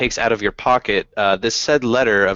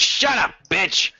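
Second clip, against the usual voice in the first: high-pitched goblin retort; snapping goblin; 9 semitones higher than usual. Acted. Goblin silences the mouthy captive, high and cross. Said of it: "He goes".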